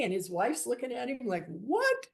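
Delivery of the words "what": high-pitched imitation of a stunned wife; mock incredulous. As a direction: voice the wife's disbelief, shooting up high. Wife reacts to husband's secret.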